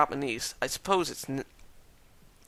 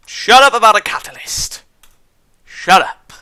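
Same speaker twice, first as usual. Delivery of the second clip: yelled, much higher and louder; angry shout; 8 semitones higher, 13 dB louder than usual. Acted. Reader yelling at the character to shut up. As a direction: snap at him to shut up.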